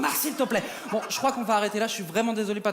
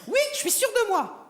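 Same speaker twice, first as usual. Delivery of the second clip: high, squeaky-pitched swapped voice; ridiculous puppet voice; 12 semitones higher than usual. Acted. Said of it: "tu récupères ta voix, tu me redonnes la mienne".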